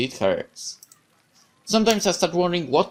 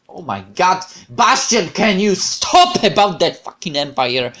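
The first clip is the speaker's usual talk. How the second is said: loud, fed-up snap at him; loud exasperated outburst; 2 semitones higher, 7 dB louder, slower than usual. Acted. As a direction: finally lose patience and let it out loud. Exasperated outburst at Bastian's Empire talk.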